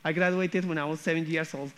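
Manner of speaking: ordinary talk, nothing marked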